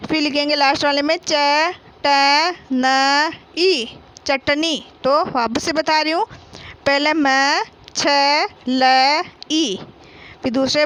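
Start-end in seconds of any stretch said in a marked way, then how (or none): 1.3-4.9 slow spelling. Shown as a demonstration. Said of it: "teacher spells then blends".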